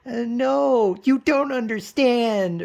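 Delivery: high loud melodramatic wail; dramatic teen whine. Retold or imitated. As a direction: overact the misunderstood teen. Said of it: "she's like".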